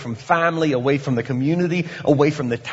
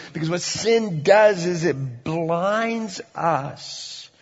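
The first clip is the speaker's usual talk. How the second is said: ordinary delivery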